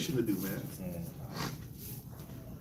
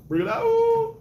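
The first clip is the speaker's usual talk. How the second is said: high, loud ringing call; high shout; 5 dB louder, slower than usual. Acted. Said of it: "He just waits for opportunities to bring it out".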